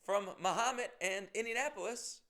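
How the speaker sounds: bright imitation of an eager fan; quoting Lori. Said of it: "She goes".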